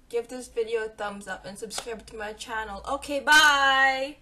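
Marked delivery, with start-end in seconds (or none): 2.9-4.2 cheery goodbye. Real, not acted. Outro sign-off.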